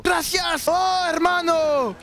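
high fake Spanish, drawn out; mock Spanish accent. Roleplayer thanking his brother, high mock Spanish accent. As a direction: stretch it in a hammed-up Spanish accent. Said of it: "mettre des sonorités espagnoles à la fin".

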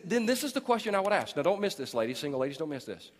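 usual speaking voice

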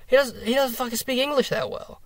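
high-pitched mock whiny complainer; mocking imitation. Fan parroting critics' complaint about his English, mocking high voice.

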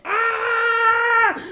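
loud falling scream; panicked scream. Retold or imitated. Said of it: "I'm like".

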